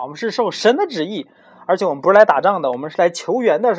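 loud, earnest envoy. A narrator's reading. Hero explains mission to guard.

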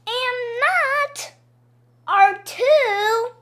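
high, loud playground retorts; childish squabbling. A narrator's reading. Missus Roopy and kids arguing who she is, squabbling. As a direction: throw it back like a stubborn kid. Childish back-and-forth contradiction.

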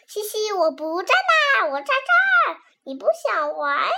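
high, loud teasing calls; calling out playfully. Acted. Lily runs around calling to the witch, high and loud. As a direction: tease the witch, shouting and giggling. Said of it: "不停地喊着".

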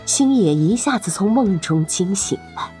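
ordinary delivery

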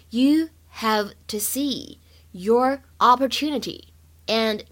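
very slow, careful model with gaps between words; slow word-by-word reading. Shown as a demonstration. Teacher models the line for learners, slow and separated. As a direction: dictate it slowly, word by word.

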